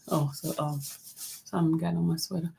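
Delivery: usual speaking voice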